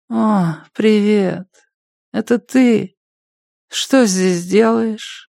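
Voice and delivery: slow, high, dazed junkie voice; stoner. Acted. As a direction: drag the words out, half asleep. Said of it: "Еле слышный заторможенный голос".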